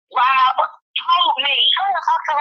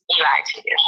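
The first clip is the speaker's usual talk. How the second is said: whisper; 7 semitones lower than usual. Real, not acted. Same words repeated in a whisper.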